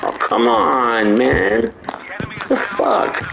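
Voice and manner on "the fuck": high, startled outburst; surprised. Real, not acted. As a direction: caught off guard, pitch jumps up. Sudden shock in game.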